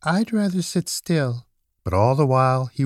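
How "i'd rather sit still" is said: high little rabbit voice; reluctant small rabbit, pitched up; little toy rabbit. A narrator's reading. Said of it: "he said".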